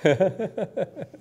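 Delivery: high giggle imitating the clerks; mock smug laughing. Imitating clerks laughing after closing the window, high giggle. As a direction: giggle like the smug ticket sellers. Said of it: "They're smiling like".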